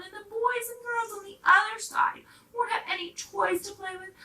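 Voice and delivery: high, loud begging plea; pleading dolls. Acted. Dolls asking the engine to pull their train, high pleading. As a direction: plead with the engine, all hope in it.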